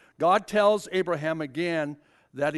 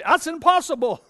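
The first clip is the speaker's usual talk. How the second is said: incredulous; 9 semitones higher, 6 dB louder than usual. Retold or imitated. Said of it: "I would say at my age".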